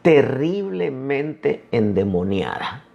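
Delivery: "terriblemente endemoniada" drawn out slowly, each word weighted; stressing the words. Shown as a demonstration. Preacher underlining Mateo's words, slow and weighty. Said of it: "Vi las palabras que usa Mateo".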